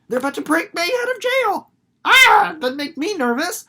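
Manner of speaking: high, loud nervous squeal; jittery Winnie before the jailbreak; mock nervous. Acting out Winnie's nerves.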